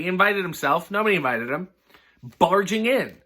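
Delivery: ordinary delivery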